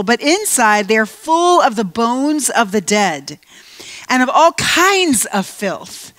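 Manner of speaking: slow, weighty prophetic reading; scripture rebuke read dramatically. Acted. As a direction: proclaim each woe slowly like a stern prophet. Preacher reading Jesus' rebuke.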